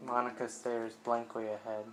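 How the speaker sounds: normal talking voice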